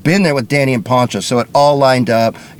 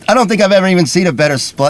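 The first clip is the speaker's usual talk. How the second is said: normal talking voice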